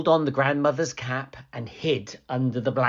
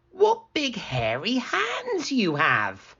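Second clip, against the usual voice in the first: put-on higher, wondering girl; curious little girl; 12 semitones higher, slower than usual. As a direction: marvel innocently.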